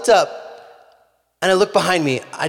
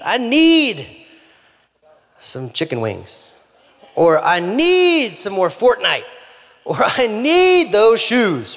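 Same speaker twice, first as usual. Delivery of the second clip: mock whiny craving; 7 semitones higher, 4 dB louder, much slower than usual. Preacher mocks petty cravings.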